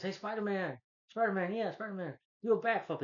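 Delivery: high mock call to a hero; eager fan calling. Bystander begging Spider-Man for a backflip, high and eager. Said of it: "some guy says".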